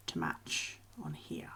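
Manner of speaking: whispered request to chat. Real, not acted. Asking the chat for opinions in a whisper. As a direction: hush down to a whisper.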